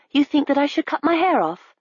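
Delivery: lightly voiced girl, curious; young girl. A narrator's reading. Nell asking the constable, lightly voiced young girl.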